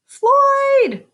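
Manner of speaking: high, loud call of a name. Acted. Neighbor calling out to Floyd, high loud call. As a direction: shout his name across the yard.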